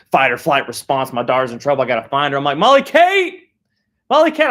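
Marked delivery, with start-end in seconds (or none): 2.6-4.5 loud, high panicked shout; hollering a name. Retold or imitated. Father searching the playground for his daughter, shouting her name. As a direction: call out for a lost child.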